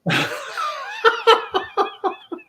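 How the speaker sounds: long high-pitched laugh; helpless high giggling. Real, not acted. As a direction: crack up in a high run of laughter. Cracks up at the capital joke.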